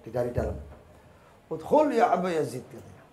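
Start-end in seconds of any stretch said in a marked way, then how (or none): 1.5-2.6 loud, higher shout of a summons; raised-voice command; calling out. Retold or imitated. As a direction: call them in from inside the house. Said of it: "sudah diteriaki dari depan".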